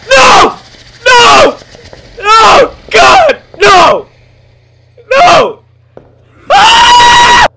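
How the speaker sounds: loud, very high repeated screams; real panic, loud and high; gasp then screaming. Real, not acted. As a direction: shriek no over and over in terror. Chased by monster.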